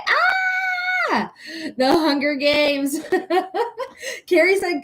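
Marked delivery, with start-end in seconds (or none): 0.1-1.3 very high, sustained squeal; delighted squeal. Real, not acted. Host hears the Effie Trinket comparison, high squeal. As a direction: let out a long, high-pitched "ah".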